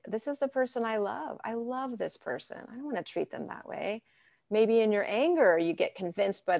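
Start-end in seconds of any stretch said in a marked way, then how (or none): 0.1-4.0 higher, much softer, tender reminder; fading to a soft tender hush; calming yourself about a partner you love; calm tender self-talk. Pretend. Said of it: "to really calm down and say".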